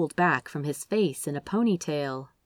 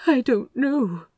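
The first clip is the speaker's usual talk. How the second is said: shakily; 7 semitones higher, 3 dB louder than usual. A narrator's reading. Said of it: "Aziraphale admitted, shakily".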